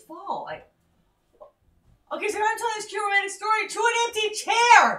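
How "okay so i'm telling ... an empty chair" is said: shouting. Real, not acted. Partner walked out mid-story.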